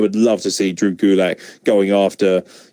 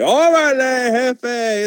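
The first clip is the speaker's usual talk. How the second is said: mock Kalisto; 13 semitones higher, much slower than usual. Mocking Kalisto's catchphrase.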